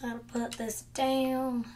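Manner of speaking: slow, drawn-out words; dragging the words out; slow odd delivery. Real, not acted. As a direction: drag each word out lazily. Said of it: "Why am I talking like this?".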